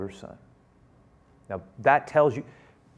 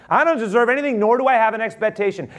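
sincere humility; 8 semitones higher, 5 dB louder, faster than usual. Acted. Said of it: "what he's saying is".

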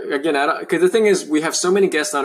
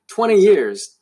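"Twenty years" repeated higher and louder, weight on "Twenty"; emphatic repeat; 7 semitones higher, 4 dB louder, slower than usual. Real, not acted. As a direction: land hard on "Twenty". Host stresses length of struggle.

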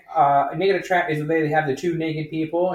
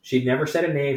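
usual speaking voice

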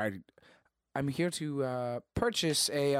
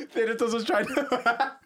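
higher-pitched, helpless laughter; laughing hard; 7 semitones higher, slower than usual. Real, not acted. Player reacting to the interruption, cracking up. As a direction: struggle to talk through laughter.

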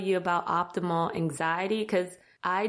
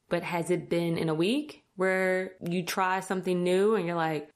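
normal talking voice

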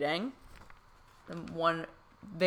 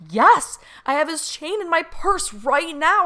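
much higher and louder, near breathless; excited confirmation; 12 semitones higher, 7 dB louder than usual. Retold or imitated. Telling the medium about the chain, thrilled and high. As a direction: blurt it out in amazed glee.

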